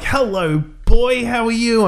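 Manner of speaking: high-pitched doggy-greeting voice; talking to a dog. Retold or imitated. Man distracting a sniffer dog, high coaxing greeting.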